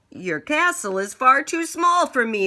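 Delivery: high loud dragon voice; dragon reply. Acted. Dragon replying loudly to the king. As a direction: scoff grandly as the big beast. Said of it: "the dragon replied".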